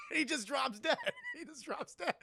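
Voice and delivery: high, laughing through the words; real amused giggling while talking; cracking up. Real, not acted. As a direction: barely get it out for laughing. Cracks up at the death scene.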